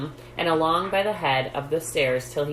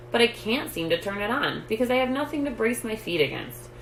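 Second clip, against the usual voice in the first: put-on a higher, small mouse-boy voice, lightly done; lightly voiced Stuart; 6 semitones higher than usual. A narrator's reading. Stuart telling his father why he can't turn the faucet, higher light voice. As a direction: pitch it up a little for the tiny boy.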